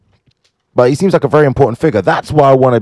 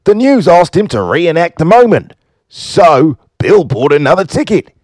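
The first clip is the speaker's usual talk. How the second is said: hammed-up Australian storytelling, pitched up and swinging; Australian accent; 5 semitones higher than usual. Host retelling a lucky Australian's story, in a put-on Australian accent. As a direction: lay on a broad, lively Australian accent. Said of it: "I've gotta do an Australian accent".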